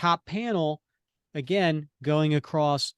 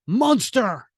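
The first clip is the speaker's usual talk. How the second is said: shouted "Monster", higher and louder; yelled insult; 8 semitones higher, 5 dB louder than usual. Acted. Cap stomping Zola's face, yelling. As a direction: hurl the word at the villain. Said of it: "And he yells out".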